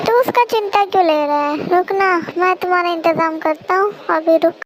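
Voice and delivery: ordinary delivery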